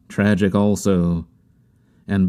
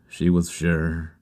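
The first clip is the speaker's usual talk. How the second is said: low, soft, slow repeat; quiet echo; 3 semitones lower, 5 dB quieter, slower than usual. A narrator's reading. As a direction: let it sink, softly and slowly. Narrator echoes her words quietly.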